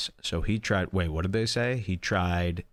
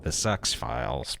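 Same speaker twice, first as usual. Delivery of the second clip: dropped to a breathy, sneering aside; mocking nickname; 5 semitones lower, 4 dB quieter than usual. Mocking the X-Files on stream, a sneering aside. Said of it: "Or as I like to call it".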